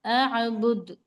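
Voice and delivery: drawn-out chanted recitation, each word held; slow Quran recitation. Shown as a demonstration. Teacher reciting the verse slowly for students. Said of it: "हम इसको ए से पढ़ेंगे".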